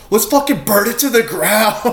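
high-pitched mock rage; super angry. Imitating Randy rallying his angry group, mock fury. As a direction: imitate a cartoon dad rallying a mob. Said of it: "just get super angry".

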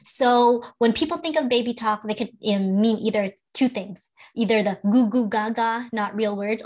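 normal talking voice

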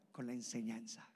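usual speaking voice

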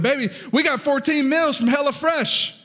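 high, slow, drawn-out southern announcement; southern accent. Retold or imitated. Husband tells wife the news in heavy southern accent. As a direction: sing out HelloFresh in a thick southern accent. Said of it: "because my southern accent".

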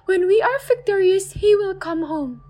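high, gentle mother's voice; soothing mother. Acted. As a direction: hush a frightened child. Mother reassures about father.

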